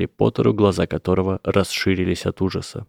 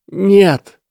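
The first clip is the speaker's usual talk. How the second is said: much higher, loud sharp outcry; desperate cry; 12 semitones higher, 9 dB louder than usual. A narrator's reading. Lesath refuses Harry's answer with a desperate cry. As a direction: cry out in despair. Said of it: "отчаянно вскрикнул Лесат".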